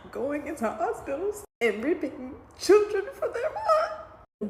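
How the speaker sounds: slow, high, tearful voice breaking into a sob-laugh; real crying as a worried mother. Real, not acted. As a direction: barely get the words out through tears. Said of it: "as a mom, I'm trying not to cry".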